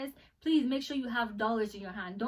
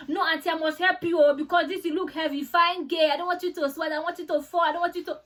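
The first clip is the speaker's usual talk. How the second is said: high, louder mimicry of a pushy Nigerian tout; insistent airport helper; 5 semitones higher, 6 dB louder than usual. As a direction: imitate a helper who won't take no. Retold helper insisting, overriding refusal.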